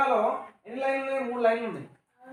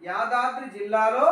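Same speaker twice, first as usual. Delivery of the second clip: slow, louder, with a little laugh; slow model reading; 6 dB louder, slower than usual. Shown as a demonstration. Teacher to pupils, slow loud reading with a laugh. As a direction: spell it out for the class to echo. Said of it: "sir ఎలా పలుకుతున్నాడు".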